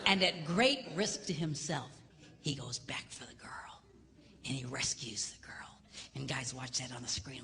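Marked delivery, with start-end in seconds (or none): 2.4-5.8 whispered climax; hushed storytelling. Real, not acted. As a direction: whisper it, spellbound.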